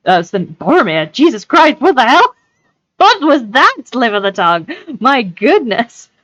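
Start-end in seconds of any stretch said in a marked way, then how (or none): 1.1-6.1 higher, louder outburst at a blunder; real exasperated surprise. Real, not acted. Reader catching a slip of the tongue, real exasperated surprise. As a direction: exclaim in disbelief at your own mistake.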